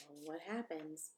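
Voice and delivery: ordinary delivery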